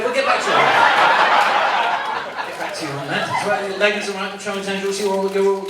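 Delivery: usual speaking voice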